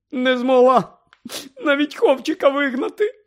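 high, tearful wail; crying. Acted. Mother crying to Harry, high and loud. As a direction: wail in shame.

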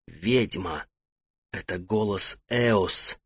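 hushed, frightened, slow; soundless whisper. Acted. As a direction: barely breathe the words out in alarm. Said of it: "неслышно произнёс слова". (едва слышный испуганный шёпот)

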